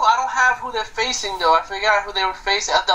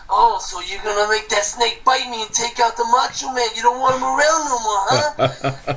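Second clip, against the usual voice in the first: Macho Man impression. Co-host imitating a wrestler accusing his rival, Macho Man impression. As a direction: play the suspicious wrestling star, hammed up. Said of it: "This is my best Macho Man impression".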